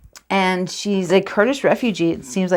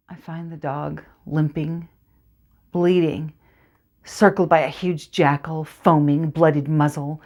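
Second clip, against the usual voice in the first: dramatic monologue narration; 2 semitones lower than usual. Acted. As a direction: draw the listener into the dark orchard.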